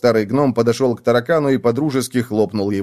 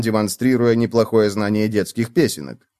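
ordinary delivery, nothing marked

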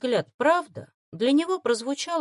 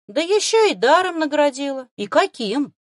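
eager, louder, with a touch of envy; envious delight; 7 semitones higher, 7 dB louder than usual. Acted. Boy asks the girl about her gift, envious and thrilled. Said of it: "лёгкая зависть и восторг".

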